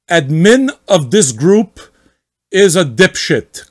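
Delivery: slow, loud, indignant rant; mocking the angry member, slow and loud; angry poster. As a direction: read it like the furious poster. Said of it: "try to read as much as possible like him".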